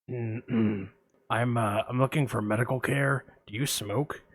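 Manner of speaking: lurk low like a shady caller; creepy caller. Retold or imitated. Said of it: "I'm gonna play both parts".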